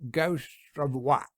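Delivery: slow, muffled words through a mouthful of meat; a lightly voiced mouth-full mumble; mumbling with mouth full. A narrator's reading. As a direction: mumble it while still chewing.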